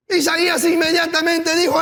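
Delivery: high, loud, impassioned sermon build; preaching at full force. Acted. Pastor rousing the congregation, impassioned loud preaching. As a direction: preach it, rising and pressing the congregation.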